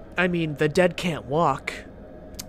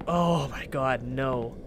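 usual speaking voice

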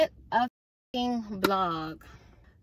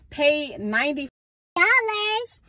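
outraged disbelief; 5 semitones higher, 3 dB louder, slower than usual. Real, not acted. Outraged at paying ninety dollars.

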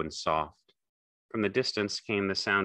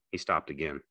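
ordinary delivery, nothing marked

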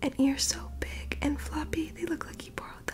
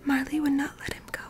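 whispered storytelling; 4 dB louder than usual. Acted. Reading Marley's story to sleepy listeners, in a whisper. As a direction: breathe the story out like a bedtime read.

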